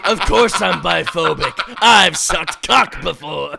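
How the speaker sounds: loud, laughing joke reply. Real, not acted. Joking back through laughter.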